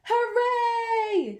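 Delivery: high sung cheer; bright high-pitched hooray; happy cheer. Acted. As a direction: cheer it out like a class of thrilled kids. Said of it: "sang the cats".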